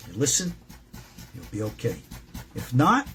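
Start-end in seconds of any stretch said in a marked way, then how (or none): none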